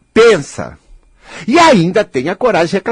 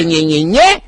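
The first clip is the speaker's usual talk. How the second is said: mocking nyeh nyeh; slower than usual. Mocking whine sound.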